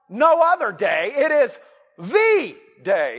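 THE stretched long and punched in "the day"; loud, slow stress on THE; emphatic THE. Shown as a demonstration. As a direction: hit THE hard, pause, then "day". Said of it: "capital D".

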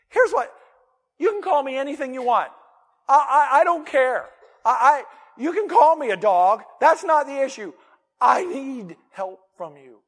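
high, loud earnest plea; pleading. Retold or imitated. The woman insisting to Jesus she only needs help, high loud plea.

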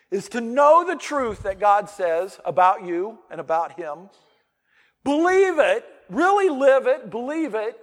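loud, high-pitched preaching build. Real, not acted. Pastor preaching God's power to the congregation, loud and high. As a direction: proclaim it to the whole church.

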